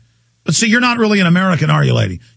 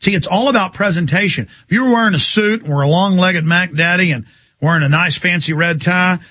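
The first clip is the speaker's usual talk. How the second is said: ordinary talk, nothing marked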